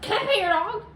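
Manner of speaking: put-on overexcited kid. Shown as a demonstration. Showing the wrong way kids ask about the dog, high and loud. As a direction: squeal it like a kid already running.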